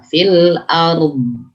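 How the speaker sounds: slow "fil arum" with drawn-out ending; lengthened dhad. Shown as a demonstration. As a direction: stretch the final dhad of "arum". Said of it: "Nah, begitu".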